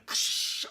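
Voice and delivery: loud hissing "kshh" of breaking glass; crash sound effect. Retold or imitated. Retelling the glass breaking in a comic, imitated crash.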